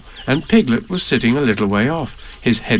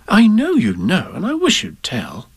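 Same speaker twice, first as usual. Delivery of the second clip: higher, then whispered wish; pleading child; 7 semitones higher than usual. A narrator's reading. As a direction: coax it out, then hush. Ann pleads, ends in whisper.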